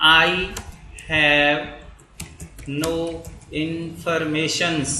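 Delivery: each word slow and spaced, with long pauses; slow dictation. Shown as a demonstration. Teacher dictating a sentence, slow and spaced. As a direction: spell it out for students to write.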